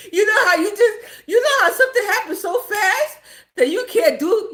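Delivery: high, loud, laughing explanation. Real, not acted. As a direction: push the words out through real laughter. Still laughing at own story.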